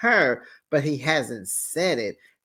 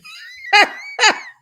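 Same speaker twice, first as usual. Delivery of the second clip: very high squealing laugh; shrieking laugh; 5 dB louder than usual. Real, not acted. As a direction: let out a piercing cackle. Laughing at recap.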